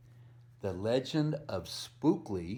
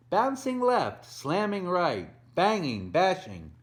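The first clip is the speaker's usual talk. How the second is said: higher and louder, tumbling out; excited storm words; 7 semitones higher, 6 dB louder than usual. A narrator's reading. Telling kids about the storm, excited and loud. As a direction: make each word bounce like a pumpkin. Storm scene in read-aloud.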